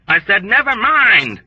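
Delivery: high, loud, slow trapped scream; a wounded man screaming, terrified and trapped. A narrator's reading. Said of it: "screamed with a trapped feeling of terror".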